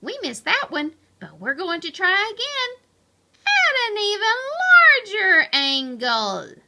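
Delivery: high, building proclamation; bold announcement. A narrator's reading. Pipsqueak announces another try to the hamsters, bold proclamation. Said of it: "and announced".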